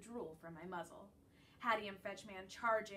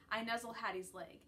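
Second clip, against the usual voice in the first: usual speaking voice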